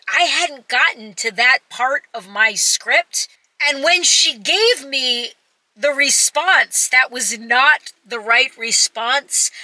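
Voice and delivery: ordinary delivery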